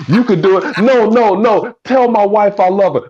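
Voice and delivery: high, loud dying plea; wounded soldier gasping. Acted. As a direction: gasp out last words. Acting out dying movie scene.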